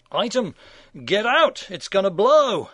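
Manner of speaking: loud, high panicked warning; alarmed shout. Acted. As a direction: yell it like the bomb is about to go. Mock alarm about exploding star.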